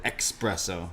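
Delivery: "espresso" mispronounced as ex-presso; wrong X pronunciation. Retold or imitated. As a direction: put a K-S sound in "espresso": ex-presso. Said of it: "he called it espresso".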